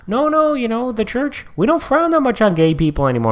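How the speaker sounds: high, mock-gentle Pope voice; chill Pope.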